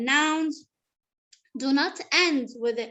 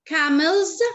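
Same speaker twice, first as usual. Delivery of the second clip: "Camels" louder, with the final s sounded out; stressed possessive ending; 2 semitones higher, 5 dB louder than usual. Shown as a demonstration. Teacher correcting a pupil's reading, stressed possessive ending. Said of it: "with an apostrophe and the S".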